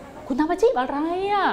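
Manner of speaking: asking the question. Shown as a demonstration. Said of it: "พอถาม".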